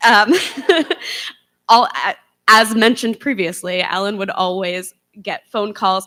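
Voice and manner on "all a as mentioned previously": ordinary talk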